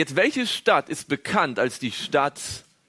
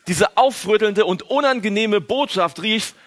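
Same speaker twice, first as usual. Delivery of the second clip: ordinary delivery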